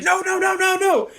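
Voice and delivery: high, rapid-fire mock protest; mock panic. Wife fending off a thrown bug, in high mock panic. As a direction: imitate a panicked string of refusals.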